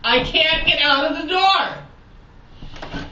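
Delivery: dawning dread, drawn out; a touch of trapped panic, slowed down; mild panic. Retold or imitated. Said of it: "Wait a minute".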